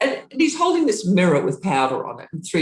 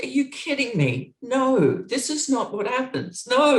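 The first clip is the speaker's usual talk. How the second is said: disbelief; 10 semitones higher than usual. Retold or imitated. Stage crew tells her Iggy's locked in.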